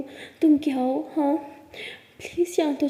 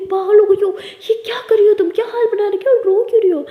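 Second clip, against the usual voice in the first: higher and louder, frantic scolding questions; alarmed mother; 6 semitones higher, 6 dB louder than usual. Acted. Mother finding her daughter sobbing on the floor, alarmed shouting. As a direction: burst in shouting with worry.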